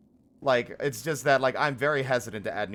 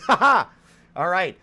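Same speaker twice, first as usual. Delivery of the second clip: all right, high and loud through a laugh; real laughing joy; 6 semitones higher, 10 dB louder than usual. Real, not acted. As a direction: laugh it out with delight. Laughing after the win.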